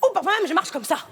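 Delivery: put-on fast, loud, high African accent. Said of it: "je veux un accent africain".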